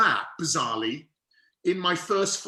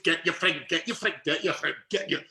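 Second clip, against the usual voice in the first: quick, repeated snatches of Scouse; ridiculous Liverpudlian accent. Shown as a demonstration. Actor practising in a train toilet, exaggerated Liverpudlian. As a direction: rehearse a guttural Liverpool accent in fragments. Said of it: "ridiculous Liverpudlian accent".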